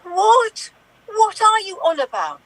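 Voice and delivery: high, loud indignant what. Acted. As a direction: shout it in disbelief. Landlady indignant outburst.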